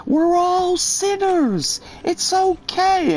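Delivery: the woman assures him everyone sins; high, slow mock preacher woman. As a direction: stretch it out in a high, pious voice.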